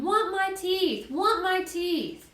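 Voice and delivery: put-on high, drawn-out toddler wail; stretched-out tantrum cry, held back in volume; Fudge. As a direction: scream for it like a small child, slowly. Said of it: "Fudge started to scream".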